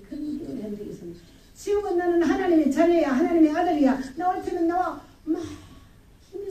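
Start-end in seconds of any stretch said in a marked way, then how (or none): 1.5-5.0 high, loud, defiant declaration; retold triumphant shout. Believer daring all comers as God's child, triumphant shout. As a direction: re-enact a new believer shouting boldly. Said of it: "막 소리 지르고".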